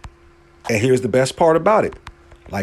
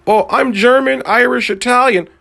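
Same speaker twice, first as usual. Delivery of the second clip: high-pitched mock claim of ancestry; Pete Davidson imitation; 10 semitones higher than usual. Comedian coming in here listing his European roots, mock imitation. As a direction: list the nationalities in a raised, imitated voice. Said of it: "Pete Davidson can come in here and say".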